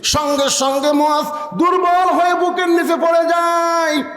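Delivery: very high sustained preaching cry; full-force preaching. A narrator's reading. Preacher at the climax of the brothers' wrestling story, high impassioned delivery. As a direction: soar at the climax, gradually descending.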